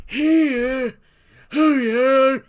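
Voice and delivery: put-on high, loud, slow old man; cranky old hermit calling out. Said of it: "how to do old man voice".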